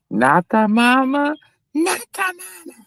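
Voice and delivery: imitating Andy. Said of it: "he said".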